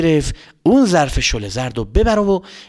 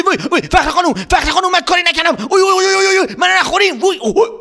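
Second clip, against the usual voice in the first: much higher, panicked wails; high frightened cries; terrified boy. Acted. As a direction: beg and shriek in terror. Emad terrified, runs.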